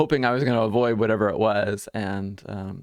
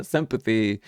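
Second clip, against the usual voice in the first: ordinary talk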